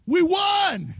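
a fan's yell of victory in heaven; triumphant cheer. Retold or imitated. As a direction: cheer like your team just won. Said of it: "up there just going".

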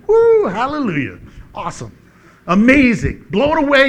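high, loud cry of wonder; excited joy. Pretend. Preacher voicing believers arriving in heaven, thrilled. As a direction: burst out with heavenly amazement.

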